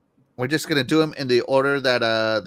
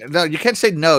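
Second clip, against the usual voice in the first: usual speaking voice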